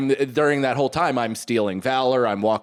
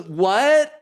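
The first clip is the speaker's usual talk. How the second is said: higher, louder "What?" through a curtain; surprised; 8 semitones higher, 4 dB louder than usual. Retold or imitated. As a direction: call back startled. Wife calls from shower.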